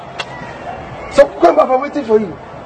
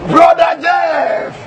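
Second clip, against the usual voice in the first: laughing call; 7 semitones higher, much slower than usual. Acted. Pastor calling out laughing.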